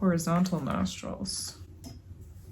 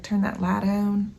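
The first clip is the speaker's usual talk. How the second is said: put-on grandma's Southern accent; 4 dB louder than usual. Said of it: "whipping out my grandma's accent".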